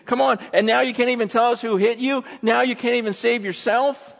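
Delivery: high, incredulous taunting; mocking. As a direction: pile on jeering challenges. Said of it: "the people here are mocking him".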